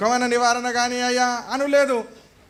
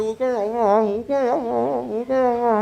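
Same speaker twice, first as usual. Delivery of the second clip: consoling neighbours; 2 semitones higher, slower than usual. Retold or imitated. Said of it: "అని వాళ్ళు ఊకో ఊస్తే".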